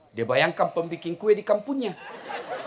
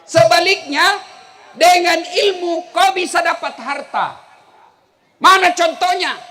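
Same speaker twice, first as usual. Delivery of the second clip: loud, high fiery preaching; preaching at full force; 9 semitones higher, 10 dB louder than usual. Acted. Preacher scolding the foolish rich to the children. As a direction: drive the lesson home to the crowd.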